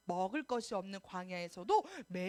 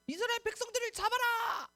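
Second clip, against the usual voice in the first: high, loud command to seize them; soldier's shout; 9 semitones higher, 4 dB louder than usual. Acted. Egyptian soldiers yelling as they chase the Israelites, loud shout. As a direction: bark it like a chasing army captain.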